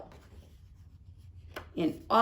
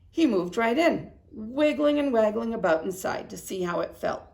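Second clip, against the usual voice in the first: usual speaking voice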